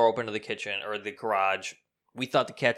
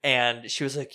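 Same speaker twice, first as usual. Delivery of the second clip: ordinary talk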